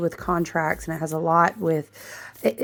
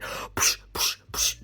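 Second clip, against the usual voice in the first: mock shushing puffs; 9 dB quieter, much slower than usual. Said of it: "she's like".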